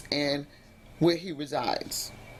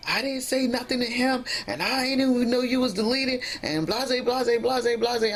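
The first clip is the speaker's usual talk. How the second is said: imitating angry partner; 7 semitones higher than usual. Imitating her significant other denying it in a heated call. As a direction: play him blowing his top, pitched up. Partner blows his top.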